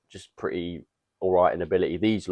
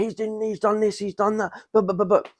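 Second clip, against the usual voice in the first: whiny mock complaint; 12 semitones higher than usual. Imitating whining German player.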